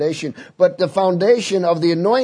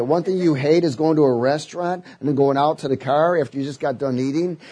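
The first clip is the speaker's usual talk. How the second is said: ordinary talk, nothing marked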